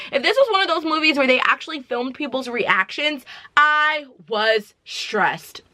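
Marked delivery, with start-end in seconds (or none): 3.5-5.6 drawn out. Real, not acted. Emphatic slow statement.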